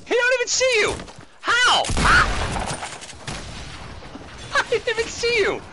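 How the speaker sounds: high, loud incredulous shouting; excited. Real, not acted. Father watching his kid sneak up on a player, excited yelling. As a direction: yell it out, pitch way up.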